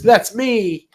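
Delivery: put-on high, proud child's exclamation; excited little girl. Little Bee spotting her wooden figure, excited. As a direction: pipe up delightedly like a child. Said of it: "she goes".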